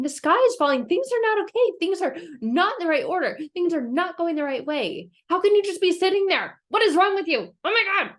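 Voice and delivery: high, loud, alarmed pleading; frantic Chicken Little. Retold or imitated. Chicken Little scolding the calm animals, high loud alarm. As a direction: sound the alarm like a panicked hen. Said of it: "It's Chicken Little saying".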